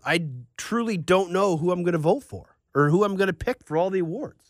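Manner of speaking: normal talking voice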